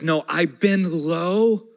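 slow, fading to a near hush; tender Jesus. Acted. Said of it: "And Jesus says".

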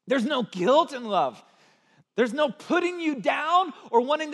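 loud, high, emphatic sermon delivery; impassioned preaching. Real, not acted. Preacher telling the congregation love does not shame, impassioned. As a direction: drive each point home at full force.

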